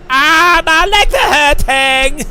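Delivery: high, loud and slow complaining voice; mock whiny wife voice. Mocking the wife complaining about her legs, high and loud. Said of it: "she's like".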